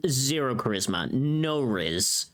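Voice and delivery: usual speaking voice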